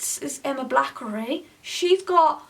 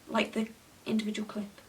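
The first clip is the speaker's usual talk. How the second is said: whisper; 4 semitones lower, 7 dB quieter than usual. Real, not acted. Aside while editing.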